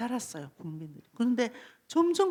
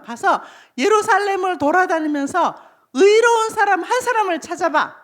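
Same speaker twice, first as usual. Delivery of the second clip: louder, higher commanding voice; commanding God; 5 semitones higher, 7 dB louder than usual. Acted. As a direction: send the prophet off with an urgent order. God's command to Jeremiah, acted. (하나님이 명령하듯 높고 힘찬 목소리로)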